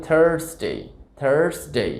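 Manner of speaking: American থার্সডে as "THURRS-day"; r-coloured vowel in থার্সডে; American pronunciation. Shown as a demonstration. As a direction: say থার্সডে with a clear r. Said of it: "রর উচ্চারণ করে থাকে".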